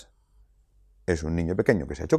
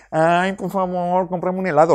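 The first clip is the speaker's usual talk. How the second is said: put-on begging child voice; 10 semitones higher, 3 dB louder than usual. An eight-year-old begging his parent for ice cream, high whiny plea. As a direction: stretch it out like a small child wheedling. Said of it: "Lo dice así".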